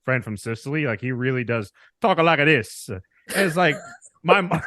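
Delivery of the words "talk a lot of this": hammed-up Italian-American accent. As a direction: imitate a thick Sicilian Italian accent. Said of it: "like, stereotypical".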